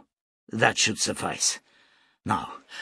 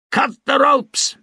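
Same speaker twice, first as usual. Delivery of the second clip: much higher, louder and slow, a full bellow; 8 dB louder than usual. A narrator's reading. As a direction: roar the order across the deck. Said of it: "bellowed Nu".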